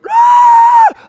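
sudden high piercing yell; mock scream of a hurt child; retold scream. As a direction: let out a loud high shriek. Daughter screams from playroom.

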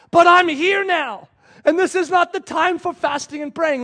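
insistent proclamation. Acted. Preacher as Jesus telling Pharisees he is here, insistent proclamation. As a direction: press the point on stubborn listeners.

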